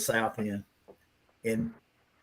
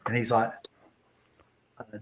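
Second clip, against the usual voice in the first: usual speaking voice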